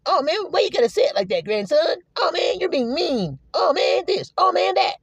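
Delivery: high-pitched mock whining; mock tearful griping; whining feelings. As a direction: play hurt feelings complaining in a squeaky-high voice. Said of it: "your feelings are the one going".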